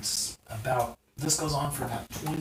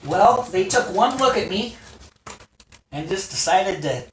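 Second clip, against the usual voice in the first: higher, louder smug dragon man; Lanny boasting; 6 semitones higher, 8 dB louder than usual. Acted. Lanny tells the heroes how the enemy fled, smug and loud. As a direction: brag about the rout, high and loud.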